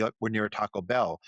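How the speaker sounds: normal talking voice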